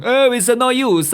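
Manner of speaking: high, slow mopey groan; gloomy old man. Acted. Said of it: "Debbie Downer in the corner, just going".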